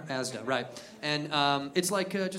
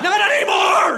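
high, loud battle shout; heroic bald eagle; 14 semitones higher, 9 dB louder than usual. Retold or imitated. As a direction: bellow as an avenging eagle. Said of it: "He's like".

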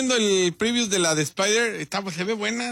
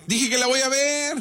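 higher-pitched, testy protest; annoyed insistence; 6 semitones higher than usual. Real, not acted. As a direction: insist you already said it, irritated. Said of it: "hablas como enojado".

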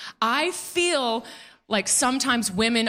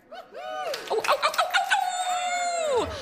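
high delighted squeal; gushing over the dogs; excited squeal; much faster than usual. Real, not acted. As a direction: coo at the dogs in the crowd. Spotting dogs in the audience.

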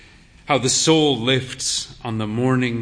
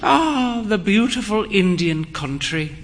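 sighing; 6 semitones higher than usual. Acted. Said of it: "she sighed".

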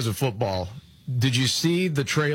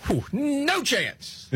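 mocking; 14 semitones higher, much slower than usual. Mocking the pundit's failed prediction.

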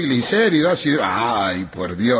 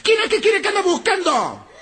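angry woman; 14 semitones higher than usual. Retold or imitated. Angry wife at 4am.

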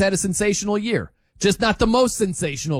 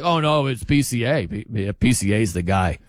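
lower, deadpan mock of the critics; imitating a deadpan pundit; mock straight face; 7 semitones lower than usual. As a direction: say it flat and dead serious, mocking them. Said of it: "People are saying it with a straight face".

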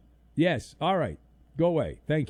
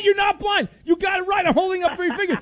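much higher, loud yelling with laughter; shouting accusation; 7 dB louder than usual. Real, not acted. Host to caller who faked being blind, shouting in mock outrage. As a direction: yell it, caught him out.

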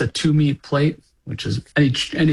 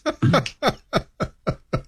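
high laugh. Real, not acted. Amused at his own answer.

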